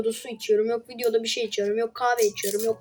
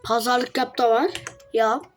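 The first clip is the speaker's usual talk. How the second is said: ordinary delivery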